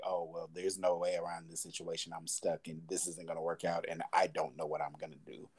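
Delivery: soft, defeated self-talk under the breath; hopeless inner voice. Retold or imitated. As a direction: let it sink as if talking only to yourself.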